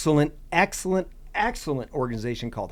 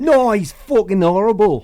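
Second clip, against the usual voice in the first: loud, high mock-outraged Scot; angry Scottish accent; 8 semitones higher, 10 dB louder than usual. Imitating angry Scots reacting to the accent, mock Scottish outrage. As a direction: shout it like a furious Scot, hammed up. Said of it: "really angry Scottish people like".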